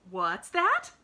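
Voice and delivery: loud, eager cut-in; excited interruption. Acted. Maid cuts in on the girl, loud and excited. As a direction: jump in eagerly. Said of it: "interrupted Nancy excitedly".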